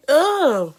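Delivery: loud, high groan of disgust; high loud ugh of disgust. Real, not acted. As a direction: groan in revulsion. Disgust at filth.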